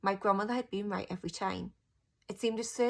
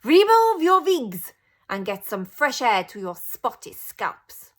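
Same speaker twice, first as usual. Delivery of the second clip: loud harsh shout at the crowd; Grand High Witch yelling at her witches; 4 semitones higher, 14 dB louder, slower than usual. A narrator's reading. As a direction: bark the order across the hall. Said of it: "she shouted".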